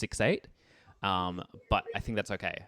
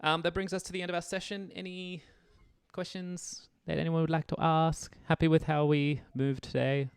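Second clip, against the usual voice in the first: higher, upbeat teacher sign-off; bright session wrap-up; 9 semitones higher, 4 dB louder than usual. Real, not acted. Teacher closing the session and inviting final questions. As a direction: lift it up to close the session. Wrapping up the class.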